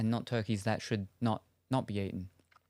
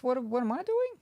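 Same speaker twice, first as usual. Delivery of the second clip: confused squeak; 11 semitones higher than usual. Real, not acted.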